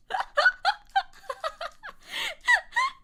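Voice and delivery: very high helpless laughter; shrieking laugh. Real, not acted. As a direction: laugh till it squeaks. Laughing after fly.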